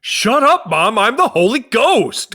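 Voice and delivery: shrieking at Mom to back off; bratty teen daughter. Pretend. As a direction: yell it like a furious thirteen-year-old. Voicing a teenage daughter.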